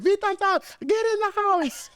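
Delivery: loud, very high-pitched yell; mock screaming mom. Mom through screen window calling child in, mock scream. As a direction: shriek it like a frantic mom. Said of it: "the mom screaming through the screen window".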